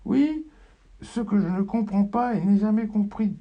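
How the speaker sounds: high, loud cry; shouting. Acted. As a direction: cry it out across the house.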